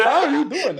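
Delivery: high, laughing question; laughing disbelief. Real, not acted. Questioning parents' love shown by beating, laughing. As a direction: ask it in amused disbelief.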